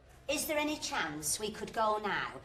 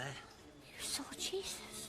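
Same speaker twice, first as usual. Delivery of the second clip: whisper; 8 semitones lower, 9 dB quieter, much slower than usual. Acted. Stunned aside about Jesus.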